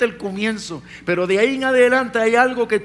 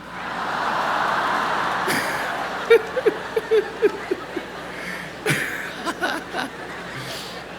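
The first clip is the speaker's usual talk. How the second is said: long laugh; 10 semitones higher than usual. Real, not acted. Preacher laughs after teasing tomorrow.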